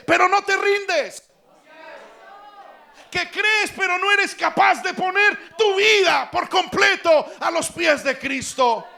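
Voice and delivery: high, slow shouted preaching; preaching at full force. Real, not acted. Preacher crying out to the congregation, at full force. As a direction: thunder it out to the congregation, slowly.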